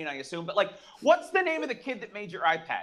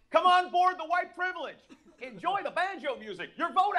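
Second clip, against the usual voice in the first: hammed-up barker; boat barker; 10 semitones higher than usual. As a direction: welcome them aboard like a riverboat host. Boat announcer.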